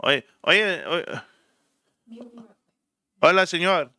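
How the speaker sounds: put-on Spanish accent, calling out briefly; hammed-up Spanish gardener accent. Shown as a demonstration. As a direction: imitate a Spanish-speaking gardener. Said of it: "The Spanish gardener accent".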